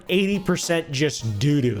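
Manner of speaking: normal talking voice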